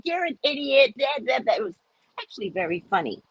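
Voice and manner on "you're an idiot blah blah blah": mock insult. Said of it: "I'm like".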